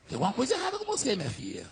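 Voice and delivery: fast, soft aside that drops away; hushed rebuke. Retold or imitated. Pastor telling the waitress something is wrong with her, hushed rebuke. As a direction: drop to a quick, confidential murmur.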